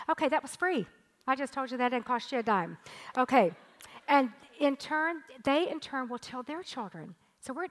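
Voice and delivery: ordinary delivery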